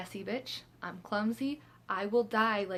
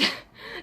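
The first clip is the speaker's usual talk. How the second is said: squeaky unvoiced giggle; high breathy laugh. Real, not acted. Laugh after punchline.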